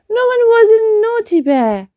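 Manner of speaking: high, quick scolding; brisk high queenly scold; queen's voice. Acted. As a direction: tut it fast and high like a queen. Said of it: "said the queen".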